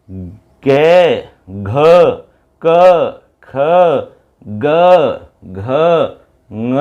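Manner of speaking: each sound said alone, with long pauses between; demonstrating throat sounds. Shown as a demonstration. Teacher showing the class how each letter sounds. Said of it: "आप बोल करके देख सकते हैं".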